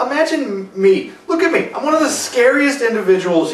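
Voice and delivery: lightly hammed classy British; classy British accent. Shown as a demonstration. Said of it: "Speaking in a classy British accent".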